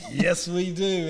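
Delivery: imitated waitress, high and loud; emphatic waitress reply. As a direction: belt out the waitress's delighted yes. Waitress's answer retold after biker's relief.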